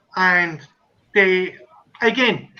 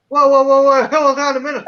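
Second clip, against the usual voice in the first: raised, louder retold backpedalling; mock alarm, higher and louder; alarmed protest; 4 semitones higher, 5 dB louder than usual. Retelling his alarmed protest to owner.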